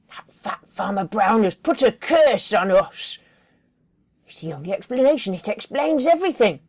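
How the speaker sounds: fast, loud ranting certainty; paranoid conviction. Acted. Joy convincing Dillard of the curse, fast and sure. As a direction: rush it out, convinced.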